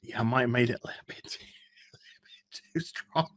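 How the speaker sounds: fast unvoiced whisper, then a giggle; whispered confession with a laugh. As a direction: keep the secret whisper going. Continues whispering about coffee.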